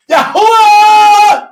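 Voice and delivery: drawn-out high shout of the name; long shouted name. Real, not acted. Preacher calling the name to the congregation, long shout. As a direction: cry the name slow and high.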